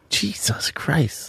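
breathless whispered Jesus Christ; stunned whisper at a couple who skip gifts; whispered disbelief. Real, not acted. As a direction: hush it out in disbelief, slowly. Shocked at no gifts.

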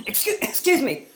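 normal talking voice

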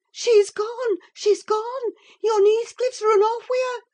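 very high, loud, panicked maid; gasped alarm. A narrator's reading. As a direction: blurt the dreadful news in a high panic. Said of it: "gasped the girl".

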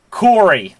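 ordinary delivery

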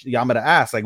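ordinary talk, nothing marked

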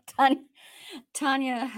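loud call of Tanya. Real, not acted. Calling out a name.

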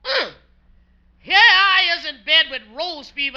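ordinary delivery

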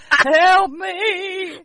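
high, loud cry for help; old prospector. Pretend. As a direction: call out like an old trapped miner. Trapped old miner.

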